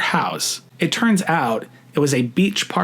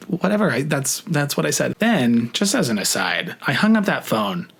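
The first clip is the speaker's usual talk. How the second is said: usual speaking voice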